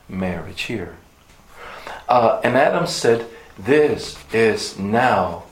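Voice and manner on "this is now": "now" drawn out slowly and leaned on hard; slow down and press on "now"; emphatic now. Shown as a demonstration. Preacher to congregation, stressing "now" slowly. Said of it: "That word now is emphatic".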